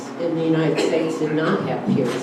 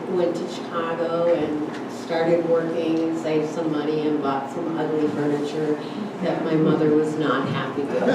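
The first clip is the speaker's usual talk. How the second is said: ordinary delivery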